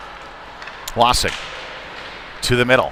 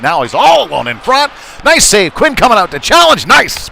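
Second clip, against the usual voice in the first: soaring, loud play-by-play call of a big save; high and loud, caught up in the moment; excited call; 9 semitones higher, 7 dB louder than usual. Real, not acted. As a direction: rise and shout as the save happens.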